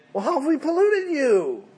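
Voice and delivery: high mock-innocent protest; protesting priests. Preacher voicing priests answering God, mock-innocent. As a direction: ask it like you've done nothing wrong. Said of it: "But you say".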